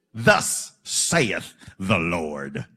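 very low, very slow "Thus saith the Lord"; booming voice of God. Retold or imitated. Quoting God to the congregation, a deep slow pronouncement. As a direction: intone it like the Almighty in King James. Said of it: "King James English".

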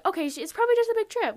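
high, loud and very fast, talking herself down; rushed self-reassurance. Retold or imitated. Girl convincing herself it's just a trip, rushed reassurance. Telling herself not to stress.

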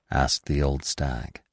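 ordinary delivery

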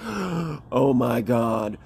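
very slow, drawn-out dawning horror; mock-shocked realisation. Running kids remembering about water snakes, mock-shocked. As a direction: stretch each word in hammed-up shock. Kids' sudden dramatic realisation.

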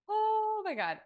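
high, loud choked-up oh my God; emotional gasp. Real, not acted. Recalling her late dog in the session, overwhelmed. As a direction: burst out overwhelmed.

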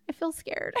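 soft unvoiced whisper; scared whisper. Waking Jason at four am, whispered fear. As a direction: breathe it like a frightened child. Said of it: "And I said".

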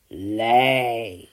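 loud, deep command; giant commanding. A narrator's reading. Giant ordering the hen to lay, loud and deep. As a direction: bark one order. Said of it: "commanded the giant".

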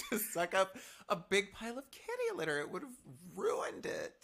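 rising high indignant; mock outrage. Real, not acted. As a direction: build to a high outraged squeak. Mock outrage over vacuum.

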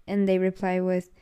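ordinary delivery

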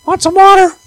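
put-on high, piping child's voice; little kid voice. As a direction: squeak it out like a small kid. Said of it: "the seven-year-old, they're the one".

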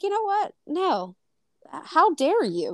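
scandalised, voice shooting up; indignant comeback. Retold or imitated. Finally answering his mother's non-apology, high-pitched indignant comeback. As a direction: pitch leaps very high on you know what, then an offended how dare you.

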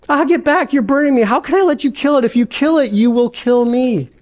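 a ghost flinching from the heat; alarmed ghost. Acted.

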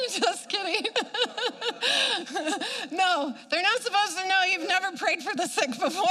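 high giggling through the words; laughing. Real, not acted. Joking to viewers about learning to heal, laughing high. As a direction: crack up at your own joke. Laughing at her own joke.

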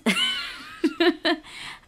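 high-pitched giggle; real high, wheezy laughter; self-mocking laugh. Real, not acted. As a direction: laugh helplessly at your own foolishness. Laughs at her own foolishness.

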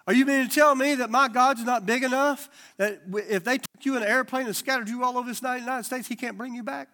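loud, high preaching build; rising fervent sermon push. Real, not acted. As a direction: preach it out, pressing the crowd. Preaching at full force.

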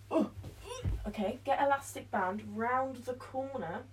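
ordinary talk, nothing marked

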